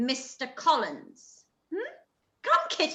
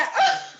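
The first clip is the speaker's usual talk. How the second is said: a high, loud, excited laugh; high giddy laugh; 6 dB louder than usual. Acted. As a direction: laugh out with glee at the news. Mother giddy at proposal.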